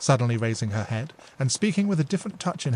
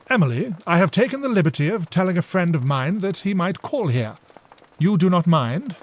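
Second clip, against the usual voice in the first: slightly raised courteous voice; a hint of a polite suitor; polite gentleman; 6 semitones higher, 5 dB louder than usual. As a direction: ask lightly. Mitchell asks Emily.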